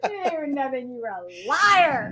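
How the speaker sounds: high, slow laughing line. Real, not acted. Mom laughing to the host about her son's lie. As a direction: say it through laughter.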